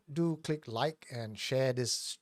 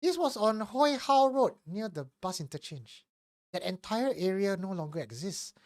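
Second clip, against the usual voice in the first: story narration; 3 semitones higher than usual. Acted. Reads a listener's confession.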